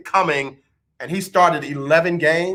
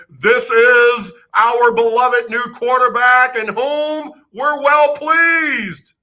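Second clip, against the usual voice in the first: slow, grand, louder proclamation; booming divine voice; 7 semitones higher, 4 dB louder, much slower than usual. Retold or imitated. Host imitating a booming heavenly voice, slow and grand. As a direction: announce like a voice from heaven. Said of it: "big booming voice say".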